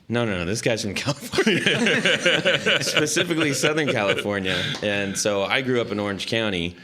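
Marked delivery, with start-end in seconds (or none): 0.1-4.6 laughing realization. Retold or imitated. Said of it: "I'm like".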